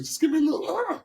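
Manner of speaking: jumps way up for the note; high falsetto demo. Shown as a demonstration. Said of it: "and then pull it back".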